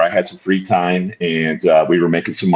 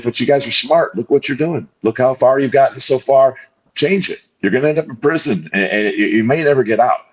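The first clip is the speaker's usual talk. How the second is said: retold cop's plea, pitched higher and pressing; earnest detective's plea; 6 semitones higher than usual. Detective pleading with young friends to change, higher earnest voice. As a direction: urge the kid like a cop who means it.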